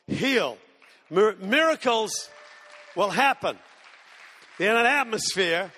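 earnest sermon build; preaching. Acted. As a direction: drive the point home from the pulpit. Preaching point with force.

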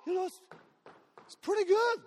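lightly voiced girl full of wonder; mock delighted girl. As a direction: play the girl thrilled her leg works. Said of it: "She goes".

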